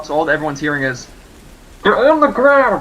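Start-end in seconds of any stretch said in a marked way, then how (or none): 1.8-2.8 muffled behind a gas mask; through a gas mask. Acted. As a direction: yell through a mask, slow. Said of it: "I'm wearing my gas mask right now".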